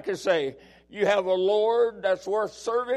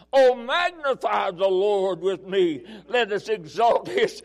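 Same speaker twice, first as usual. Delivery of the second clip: very high, loud shouted praise, slow; ecstatic preaching; 6 semitones higher, 9 dB louder, slower than usual. Real, not acted. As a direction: burst into joyful shouting.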